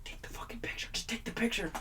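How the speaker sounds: urgent whisper, barely voiced; hissing it under the breath so she can't hear; mouthed whisper. Urging the singer to just snap the photo, whispered. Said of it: "I'm mouthing to him".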